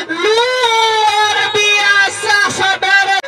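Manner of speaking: drawn-out goal scream; frantic mock sports commentary. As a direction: yell the goal, stretching every word. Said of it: "goal called in live commentary".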